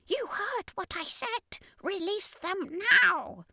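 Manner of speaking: very high, slow alien demand; alien commander. Acted. Commander demanding soldiers obey, very high alien voice. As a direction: insist in a high, strange alien voice.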